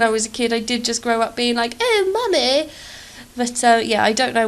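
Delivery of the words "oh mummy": high, hammed-up posh English accent, louder; posh snooty accent. As a posh English kid calling mummy, snooty posh accent. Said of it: "I sound really snooty".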